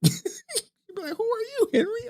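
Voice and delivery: squeaky high laughing quote. Retold or imitated. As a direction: blurt it out through laughter.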